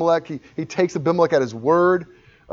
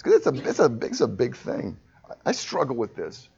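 ordinary talk, nothing marked

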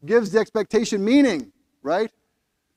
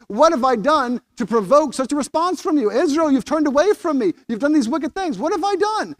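higher, louder pleading voice of God; a grieving God asking his people what he did; voicing God pleading; 8 semitones higher, 3 dB louder than usual. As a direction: plead as a wounded father. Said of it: "he says".

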